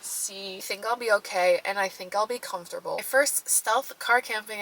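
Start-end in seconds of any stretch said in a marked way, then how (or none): none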